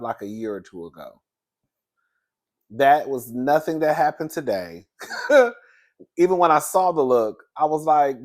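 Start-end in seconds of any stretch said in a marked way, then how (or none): 5.0-5.5 short high burst of laughter; bright loud laugh. Real, not acted. Laughs at misinformation.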